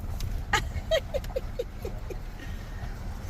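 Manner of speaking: long, high, breathy giggle; delighted squeaky laughter. Real, not acted. Laughing at the animals.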